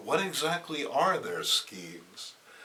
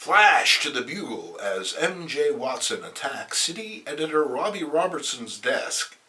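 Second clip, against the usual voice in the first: usual speaking voice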